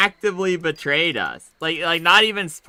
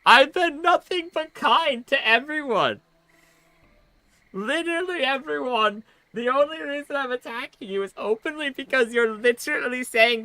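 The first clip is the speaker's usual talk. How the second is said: high, fast, pleading outrage; exasperated rant; 6 semitones higher than usual. Real, not acted. Gamer ranting at the guard captain, high exasperated outrage. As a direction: argue back at the screen, voice pitched way up. Player rants at the game NPC.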